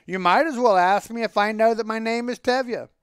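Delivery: animated, lightly amused comeback; trying cheerful. A narrator's reading. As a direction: answer with put-on good cheer. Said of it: "still trying to sound cheerful".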